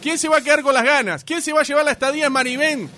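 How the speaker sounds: announcer suspense. Real, not acted. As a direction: tease the winner with pauses between questions. Host builds suspense before result.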